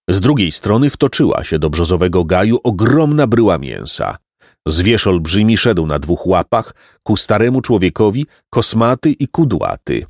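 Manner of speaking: plain reading voice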